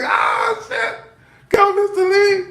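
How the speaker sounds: high, shouted hype; whooping cheer. Retold or imitated. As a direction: cheer him on at full voice. Cheering on the ranting cook.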